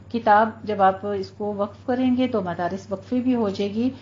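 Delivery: normal talking voice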